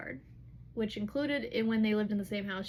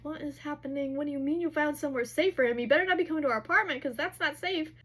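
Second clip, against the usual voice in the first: acted disbelief, higher and quickening; alarmed protest; 5 semitones higher, 5 dB louder, faster than usual. Hunt objecting to the kid's new plan, alarmed. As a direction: fire off worried objections in a raised voice.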